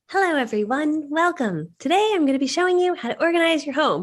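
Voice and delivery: mock her old overly sweet channel intro; a hint of a sicky sweet host; fake YouTuber voice. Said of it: "you see me on there so fake".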